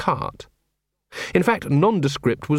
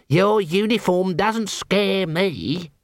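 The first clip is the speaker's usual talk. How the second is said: slow, higher, a try at a sneer; attempted sneer; 6 semitones higher, much slower than usual. A narrator's reading. Young assassin to Vimes, trying to sneer. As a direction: sneer but not quite pull it off. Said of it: "tried to sneer".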